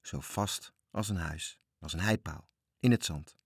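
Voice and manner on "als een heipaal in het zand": plain reading voice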